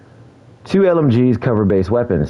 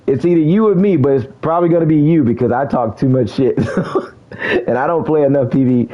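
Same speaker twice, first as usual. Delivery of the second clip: excited laughing; 8 semitones higher than usual. Real, not acted. Hyped by getting in-game hoodie.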